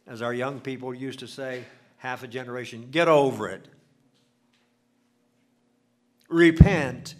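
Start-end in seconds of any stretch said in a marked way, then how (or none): 2.9-7.1 sudden loud exhortation; loud call to repent. Acted. Preacher urges the angry listener to repent, shouting. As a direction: blast Repent at the congregation.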